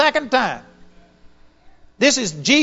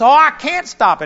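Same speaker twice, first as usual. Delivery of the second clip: 1 protesting; 6 semitones higher, 6 dB louder than usual. Retold or imitated. Woman protesting.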